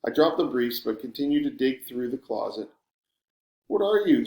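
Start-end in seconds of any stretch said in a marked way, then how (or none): 3.7-4.2 teasing. A narrator's reading. Teasing friend's question.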